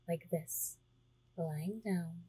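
ordinary talk

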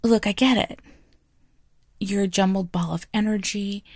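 ordinary delivery

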